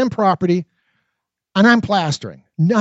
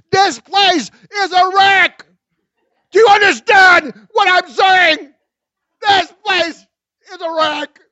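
much higher, loud shouting; furious rant; 13 semitones higher, 7 dB louder than usual. A narrator's reading. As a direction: rage at them. Ranting at the retreating couple.

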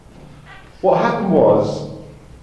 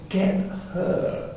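pantomime Dave voice; 6 semitones higher, 9 dB quieter than usual. Retold or imitated. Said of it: "in his pantomime Dave voice".

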